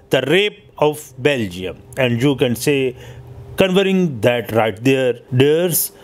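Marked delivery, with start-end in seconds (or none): none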